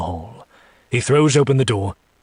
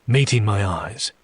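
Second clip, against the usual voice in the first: ordinary delivery, nothing marked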